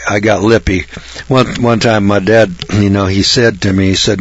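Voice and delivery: normal talking voice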